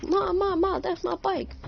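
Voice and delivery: put-on high, urgent little kid crying out Ma; frantic kid calling mom. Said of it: "I was like".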